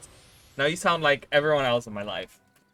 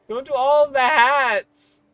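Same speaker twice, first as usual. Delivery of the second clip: high, loud, slow whiny protest; mock whine; 11 semitones higher, 10 dB louder, much slower than usual. Streamer whining back at chat about the hats. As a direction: drag it out like a whining kid.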